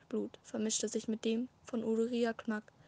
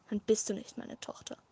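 ordinary delivery

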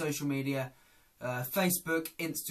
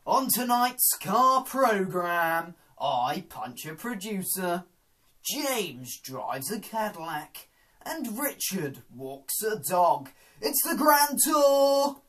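slow presenter build to a loud high finale; Jeremy Clarkson impression; 4 semitones higher, 7 dB louder, slower than usual. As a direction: announce the show like Clarkson. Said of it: "it's Jeremy Clarkson".

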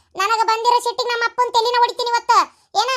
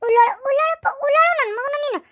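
mock lament; 4 semitones higher than usual. Wife mock-cries over her husband.

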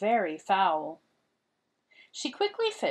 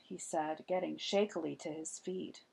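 plain reading voice

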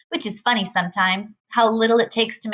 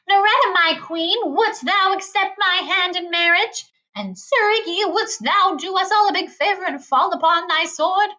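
mock knight; 9 semitones higher than usual. A narrator's reading. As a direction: ham up a courtly knight proposing. Freak hamming up knightly speech.

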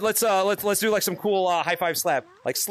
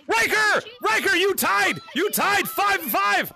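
high-pitched, thrilled calling out; excited shout; 9 semitones higher, slower than usual. Real, not acted. Streamer telling a player of the tie, excited shout. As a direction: holler the news in high excitement.

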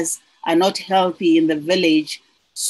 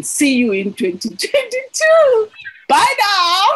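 high bright sign-off, louder; cheery goodbye; 13 semitones higher, 3 dB louder than usual. Real, not acted. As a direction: sing out a happy farewell.

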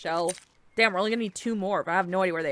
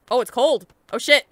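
ordinary delivery

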